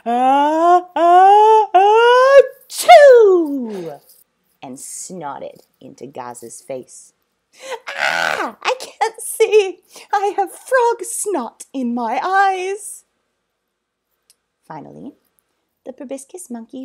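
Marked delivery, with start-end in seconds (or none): 0.1-4.0 building to a huge loud sneeze; massive sneeze. Acted. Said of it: "did a massive sneeze". 7.9-13.1 high, loud, slow wail; whiny blinded boy. Acted.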